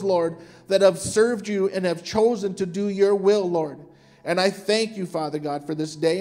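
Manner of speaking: ordinary delivery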